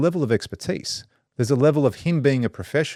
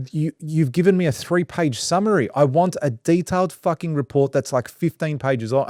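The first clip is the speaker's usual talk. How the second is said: retold frustration; 6 semitones higher than usual. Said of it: "I go".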